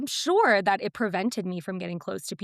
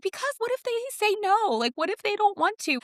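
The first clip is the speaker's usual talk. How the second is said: mock anxious whine; 13 semitones higher than usual. Retells her own anxious reply.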